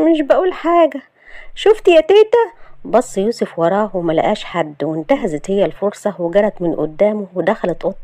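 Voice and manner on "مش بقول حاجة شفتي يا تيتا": high voice leaping up at the end; flustered, tattling. Acted. Shahd pretending grandma is behind Yousef, flustered. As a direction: pitch it up to distract him. Flustered, then tricks him to escape.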